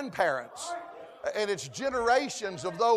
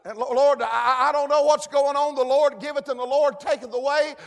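loud, higher impassioned preaching; preaching as Job; 5 semitones higher, 7 dB louder than usual. Acted. Preacher voicing Job crying out to God, loud and higher. Job's lament, preached at full force.

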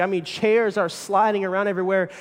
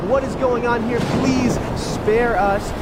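higher, louder, begging slowly at the end; panicked crying out; 7 semitones higher, 6 dB louder, slower than usual. Acted. Sailors begging their gods in the storm, panicked. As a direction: cry out to the gods in terror. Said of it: "calling out and crying out".

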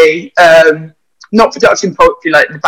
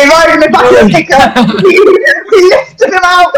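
high-pitched, helpless laughing speech; 10 semitones higher than usual. Real, not acted. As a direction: crack up while telling it, voice shooting up high. Teasing about stolen alpacas, cracking up.

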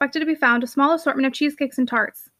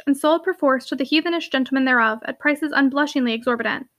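ordinary delivery

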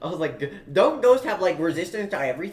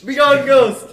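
loud, high mock exorcism; commanding exorcist; 7 semitones higher, 10 dB louder, slower than usual. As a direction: banish the ghost with a shout. Exorcising the ghost.

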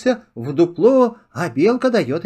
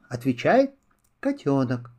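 ordinary delivery

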